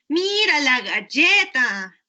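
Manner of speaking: put-on slow, drawn-out, sing-song child-directed voice; parent cooing to child. Parent showing a child the cookie, slow pretend coaxing. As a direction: stretch each word as if for a toddler.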